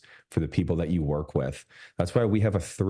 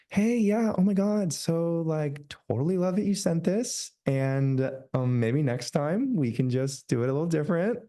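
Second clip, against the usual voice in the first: much higher, fake-friendly client voice; mock customer service voice; 8 semitones higher than usual. Coach mocking how people give client feedback, in a high fake-friendly voice. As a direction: put on the over-sweet voice for client feedback. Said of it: "your customer service voice that you put on".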